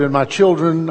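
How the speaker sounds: ordinary talk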